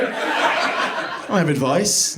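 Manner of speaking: normal talking voice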